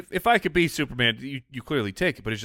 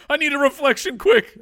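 urgent call; 13 semitones higher, 6 dB louder than usual. Acted. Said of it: "You just have to say it out loud, like".

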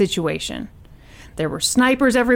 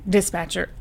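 usual speaking voice

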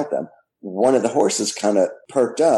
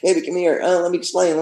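pleading; 8 semitones higher, faster than usual. Retold or imitated. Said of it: "he's following us going".

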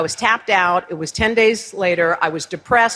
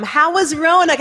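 higher, bright motherly question; mom asking; 8 semitones higher than usual. Retold or imitated. Mom on the phone asking about the interview, lightly voiced higher.

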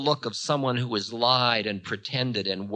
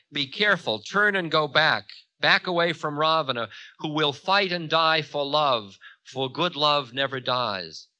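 slightly raised, firm heroic warning; Ravana, commanding warning; 5 semitones higher, 5 dB louder than usual. A narrator's reading. Ravana ordering Time to back away, commanding. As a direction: warn him off with conviction.

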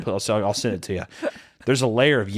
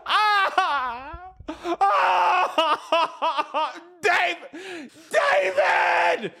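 high, desperate wailing scream; wail, then scream the name in anguish; screaming for child; much slower than usual. Acted. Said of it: "I was yelling out for David".

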